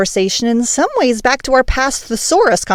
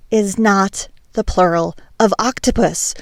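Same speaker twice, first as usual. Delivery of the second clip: ordinary talk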